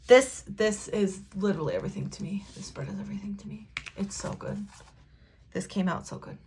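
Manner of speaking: soft, fast, half-whispered delight; quiet whisper over food; hushed admiring whisper. Real, not acted. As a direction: murmur it to yourself, pleased. Admiring the finished spread.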